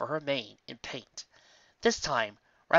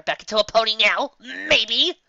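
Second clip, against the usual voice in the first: higher and louder, pleading; exasperated plea; 10 semitones higher, 8 dB louder than usual. Acted. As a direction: beg them to drop it. Trixie pleading to change topic.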